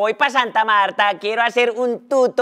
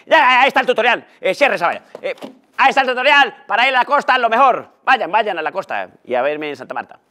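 ordinary delivery

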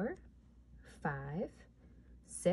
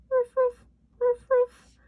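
yappy dog barks; 10 dB louder than usual. Acted. Dog noises in counting song.

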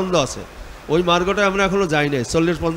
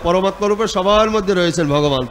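ordinary talk, nothing marked

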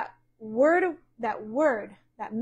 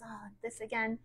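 mumbled complaint; 10 dB quieter than usual. Retold or imitated. Listener grumbling about a repeated series, mumbled complaint. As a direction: imitate a weary churchgoer mumbling a complaint. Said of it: "I've heard mumblings and complainings".

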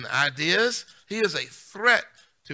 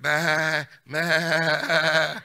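mock sheep bleating; a playful imitation of bleating sheep; 5 dB louder than usual.

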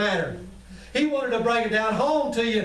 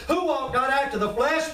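normal talking voice

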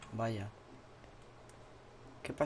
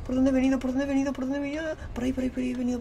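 panicky repeating; 8 semitones higher, much faster than usual. Real, not acted. Lost while being chased.

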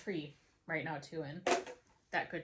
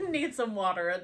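laughing; 3 semitones higher, 8 dB louder than usual. Real, not acted. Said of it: "My throat is parched".

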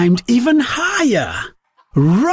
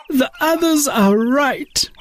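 higher, defeated whimper; tearful; 8 semitones higher than usual. Acted. Daisy agrees with the mocking chickens, crying. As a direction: give up in tears. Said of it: "Daisy cried to Mama".

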